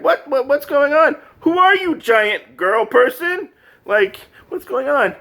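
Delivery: high, hammed-up confused puppy; mock bewilderment. Puppy facing a giant girl person, mock bewildered. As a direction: squeak out startled questions.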